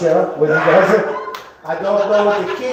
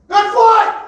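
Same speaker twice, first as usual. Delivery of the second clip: very high, loud mock shout; headphone shouting; 8 dB louder than usual. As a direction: yell it as if deafened by headphones. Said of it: "Kids screaming, they're looking at me going".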